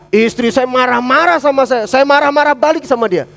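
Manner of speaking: heated, loud and high; marah-marah. Acted. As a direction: raise the voice as in a mutual quarrel. Example of both spouses getting angry. (suara keras dan tinggi, marah-marah)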